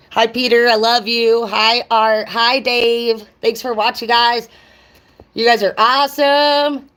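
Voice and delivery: loud, high bubbly shout-outs; excited sign-off. Real, not acted. Greeting Peter and the viewers, loud and excited. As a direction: beam at your viewers as you wrap up.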